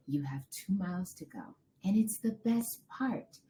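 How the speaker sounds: hushed imitation of husband encouraging; soft coaxing. Husband coaxing runner through last two miles, imitated soft encouragement.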